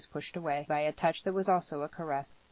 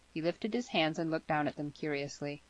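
ordinary delivery

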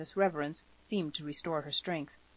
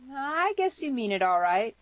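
put-on higher, louder girl making up her mind; grudging acceptance in a higher voice; deciding aloud; 8 semitones higher, 6 dB louder than usual. A narrator's reading. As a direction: concede it, pitched up. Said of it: "she decided aloud".

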